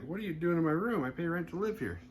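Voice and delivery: high-pitched mock indignation; indignant. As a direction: protest like an affronted tenant. Herbert indignantly challenges the intruder.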